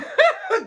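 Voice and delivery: sudden high cackle of real laughter; real shrieking laugh; high burst of laughter. Real, not acted. Laughs at own story.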